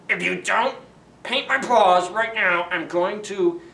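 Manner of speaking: loud, high menacing growl of a threat; loud rising wolf threat. Acted. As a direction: threaten to eat him up.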